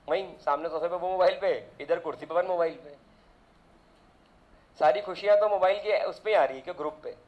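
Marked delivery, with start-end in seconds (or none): none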